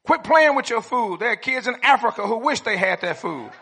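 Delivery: dad voice. Retold or imitated. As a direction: scold a kid at the table. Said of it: "I heard this one a lot".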